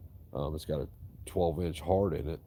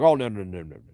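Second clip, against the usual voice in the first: mock loud refusal; 3 semitones higher, 11 dB louder than usual. Customer refusing, retold.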